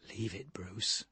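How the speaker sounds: a toneless whisper; Bladesy whispers to Bruce to drop it. A narrator's reading. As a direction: whisper urgently to calm him down. Bladesy hushes Bruce on plane.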